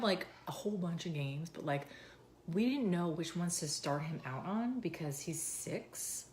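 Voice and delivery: normal talking voice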